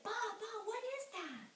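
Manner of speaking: put-on high-pitched child's question; alarmed young boy. Boy asking his father about the shape, alarmed. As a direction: call for Dad in a high, urgent voice.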